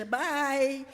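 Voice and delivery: high, loud curt bye; dismissive goodbye. Retold or imitated. To the boyfriend with the baby, a curt dismissive bye. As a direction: toss the bye off and leave.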